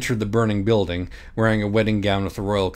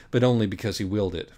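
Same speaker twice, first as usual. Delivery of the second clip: ordinary delivery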